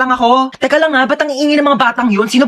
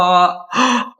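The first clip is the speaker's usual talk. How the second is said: shocked gasp and cry; 4 semitones lower than usual. Acted. Student gasping at her quiz score, shocked cry. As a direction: gasp in horror at the grade.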